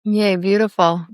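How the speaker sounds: ordinary delivery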